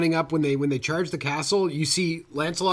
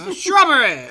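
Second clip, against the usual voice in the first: much higher, louder exclaimed shrubbery!; hammed-up knight demanding a shrubbery; shouted quote; 11 semitones higher, 5 dB louder than usual.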